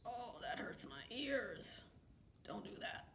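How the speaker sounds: soft, slow, hushed complaint. Real, not acted. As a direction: wince and hush. Cat is loud.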